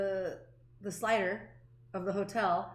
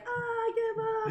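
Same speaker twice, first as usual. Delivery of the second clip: defeated dog voice; 11 semitones higher than usual. Retold or imitated. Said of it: "he kinda rolls over".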